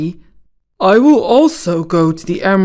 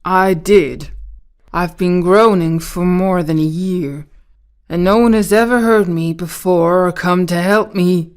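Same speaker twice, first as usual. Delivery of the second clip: sad voice. A narrator's reading. Rusted tin man tells Dorothy his trouble, sad voice. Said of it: "the sad voice in which the man spoke".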